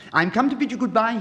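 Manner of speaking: high, loud and quick; crying out goodbye. Acted. Swallow crying farewell to the happy prince. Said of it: "he cried".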